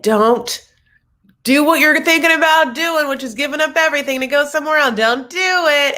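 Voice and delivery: high, loud alarmed warning; pleading friends. Retold or imitated. Friends warning someone not to give up everything, high and alarmed. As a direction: beg them not to go through with it. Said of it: "with their friends like".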